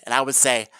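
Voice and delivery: ordinary talk, nothing marked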